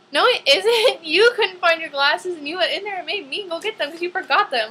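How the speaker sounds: high, loud exasperated protest; indignant retort. Acted. Niece protesting her aunt's blame over the glasses, indignant. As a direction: argue back, laughing in disbelief.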